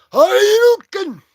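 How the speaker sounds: high, slow, loud battle cry; Street Fighter move call. Retold or imitated. Shouting a video game attack at a comic villain, loud battle cry. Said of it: "He's like".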